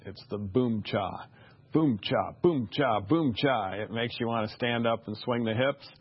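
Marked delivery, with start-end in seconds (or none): 0.4-3.7 punchy, rhythmic boom-cha beat imitation; vocalized drum beat. Shown as a demonstration. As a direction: mimic a syncopated beat, hitting each pulse. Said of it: "the first beat emphasized with a deep beat".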